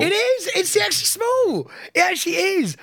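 very high, squeaky mock pride; mock proud squeal. Joking about his extra small condom in a very high squeak.

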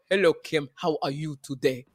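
imitating an audition African accent. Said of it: "We all were trying to do an African accent".

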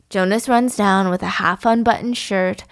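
usual speaking voice

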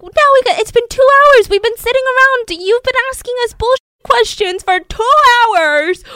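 police fed up with Sanford, loud exasperated outburst; exasperated yelling cops. Retold or imitated. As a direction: rant like furious officers. Cops fed up after hours.

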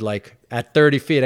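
ordinary talk, nothing marked

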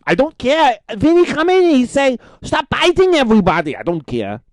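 high, loud put-on Asuka voice with broken English; Asuka impression. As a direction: play the wrestler who thinks it's all real. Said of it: "Her name is Asuka".

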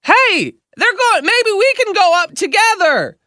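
high mock wife voice calling across; wife calling over. Wife calling to husband seats away, high mock voice. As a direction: call over to the husband in a squeaky put-on voice. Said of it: "this lady goes".

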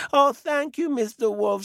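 put-on high, loud delighted old woman; grateful old lady. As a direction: gush with relief like a tiny granny. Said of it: "said the old lady".

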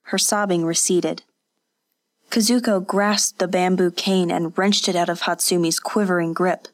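plain reading voice

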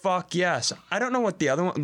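usual speaking voice

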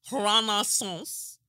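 slow, drawn-out "Renaissance" with a put-on French-style sound; exaggerated pronunciation. Host naming the Beyonce concert, "Renaissance" slowly overpronounced. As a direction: stretch "Renaissance" into a mock-French flourish.

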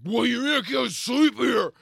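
high, slow, slurred bellow; imitated belligerent drunk; drunken yell. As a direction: holler it in drunken outrage. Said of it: "He's, like, stumbling around".

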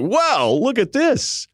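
high, slow amazed gasp of a line; awed buddy. Retold or imitated. Buddy at the convention gawking at the girl, high and slow. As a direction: gawk at her, drawn out and high.

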